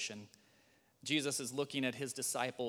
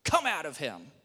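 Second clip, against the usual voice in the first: stern command; 5 semitones higher, 12 dB louder than usual. Acted. Jesus commanding the spirit.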